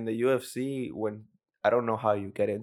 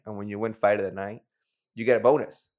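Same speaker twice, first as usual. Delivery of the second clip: ordinary talk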